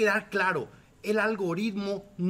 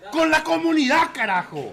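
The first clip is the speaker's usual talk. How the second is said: laughing shout; 7 semitones higher, 8 dB louder than usual. Real, not acted. Defiant laughing outburst.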